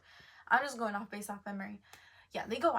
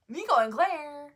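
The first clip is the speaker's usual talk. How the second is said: catching own mistake; 5 semitones higher, 12 dB louder, slower than usual. Real, not acted.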